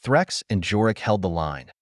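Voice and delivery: usual speaking voice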